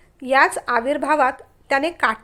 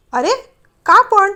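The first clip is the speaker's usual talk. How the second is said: much louder, higher annoyed why; irritated why; 6 semitones higher, 8 dB louder than usual. Acted. Man irritated she won't let him leave, loud and higher. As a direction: demand an answer, irritated. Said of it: "त्याने चिडून विचारलं".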